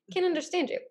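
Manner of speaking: high, mock-dismissive complaint; rejecting. Shown as a demonstration. Speaker showing how not to answer someone, mock rejecting. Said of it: "That is rejection".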